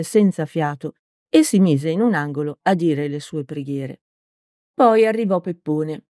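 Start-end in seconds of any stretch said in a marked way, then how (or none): none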